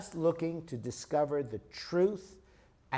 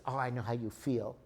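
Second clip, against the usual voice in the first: softer, lower, gentle reassurance; empathetic; 5 semitones lower, 6 dB quieter than usual. Shown as a demonstration. Husband comforting upset wife, soft and empathetic. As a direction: soothe her, soft and low, full of empathy. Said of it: "that's all the emotional person wants".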